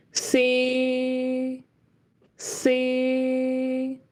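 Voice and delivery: C held flat and steady; flat mid tone. Shown as a demonstration. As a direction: keep C level, no movement. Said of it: "Very flat".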